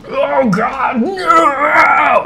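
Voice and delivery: high desperate shout; panicked cry. Retold or imitated. Bodega owner crying out in panic as he mutates. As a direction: cry out in terror as he changes. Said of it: "he's like".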